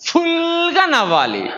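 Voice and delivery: high, stretched-out ఫుల్గా; drawn-out emphasis. Shown as a demonstration. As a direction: hold ఫుల్గా long and high, then drop. Answers own question on laughing fully. (ఫుల్గా ను ఎత్తైన స్వరంతో సాగదీసి)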